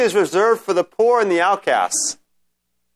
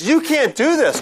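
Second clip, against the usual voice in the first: much higher, urgent Peter objecting; Peter's protest; 9 semitones higher than usual. Retold or imitated. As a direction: protest as if to stop him. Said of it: "But it's".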